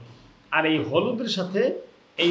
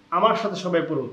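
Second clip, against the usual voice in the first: normal talking voice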